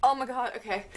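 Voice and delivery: normal talking voice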